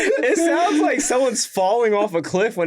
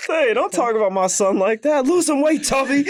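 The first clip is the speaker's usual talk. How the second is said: mock cartoon quarrel; 7 semitones higher than usual.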